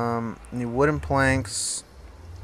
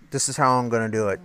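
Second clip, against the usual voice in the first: usual speaking voice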